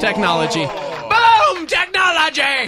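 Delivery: booming hype shout, high and slow; excited shout. Acted. Hosts hyping up technology.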